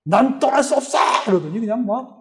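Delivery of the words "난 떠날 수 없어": higher, strained demon voice; frenzied demon. Retold or imitated. Possessed woman refusing to leave, frenzied mock wail. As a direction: imitate a possessed woman wailing in defiance. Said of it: "발광을 하기 시작하는 거예요".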